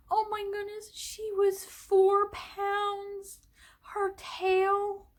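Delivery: real emotion welling up, drawn out high; getting emotional. Real, not acted. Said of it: "I'm getting a little emotional".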